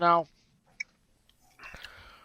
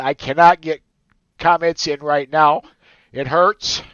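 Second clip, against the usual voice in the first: tearful, pushing the words out louder; emotional; 5 dB louder than usual. Real, not acted. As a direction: overcome while saying goodbye to listeners. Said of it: "I wouldn't get all emotional".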